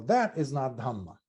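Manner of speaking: normal talking voice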